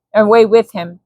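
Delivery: usual speaking voice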